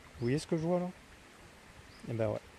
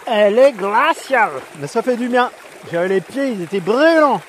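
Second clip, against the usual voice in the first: loud, high, thrilled by the cold; louder, buzzing from the icy water; excited; 8 semitones higher, 12 dB louder than usual. Real, not acted. (excité et fort)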